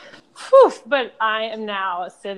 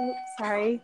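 normal talking voice

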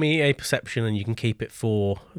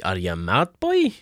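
aging farmer; 3 semitones lower than usual. Acted. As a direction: challenge them as an affronted old farmer. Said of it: "aging farmer".

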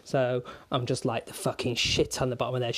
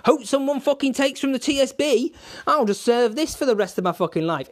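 mock office worker; 10 semitones higher, 5 dB louder than usual. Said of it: "three fucking mortgage lenders going".

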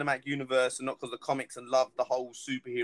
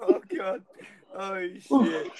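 high laughing gasp; 9 semitones higher, 11 dB louder, slower than usual. Real, not acted. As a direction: laugh till it hurts. Laughing hard.

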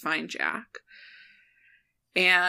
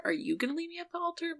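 higher mock plea; 12 semitones higher, 5 dB quieter, faster than usual.

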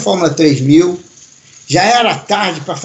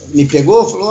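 ordinary talk

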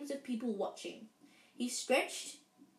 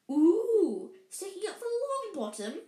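high, mocking schoolgirl coo with a loud "ooh"; taunting; 9 semitones higher, 7 dB louder than usual. A narrator's reading. Classmate taunts Parvati in story.

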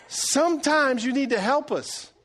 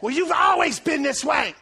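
raised, higher accusing outburst; mock fighting voice; 6 semitones higher than usual. Husband bringing up wife's past in a fight, mock angry. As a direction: throw it out like an argument jab. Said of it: "when we're fighting".

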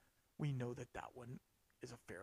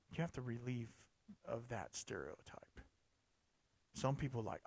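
ordinary talk, nothing marked

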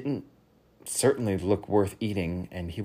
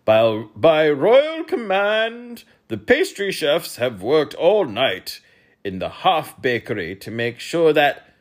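loud, slow, higher king's voice; royal proclamation; 7 semitones higher, 8 dB louder, slower than usual. A narrator's reading. King proclaiming the pastry treat to the hall, loud and slow. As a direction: proclaim it slowly and loudly.

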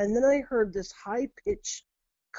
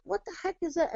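startled; 9 semitones higher, 6 dB quieter, faster than usual. Retold or imitated. Retold startled reaction.

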